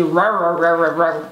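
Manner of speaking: "rá, rá, rá, rá" as a hard, barking American R. Shown as a demonstration. Teacher mocking the American R for students. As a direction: bark out the R like a dog. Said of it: "que parece tá latindo americano".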